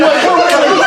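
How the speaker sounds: ordinary talk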